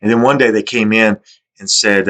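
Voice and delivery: usual speaking voice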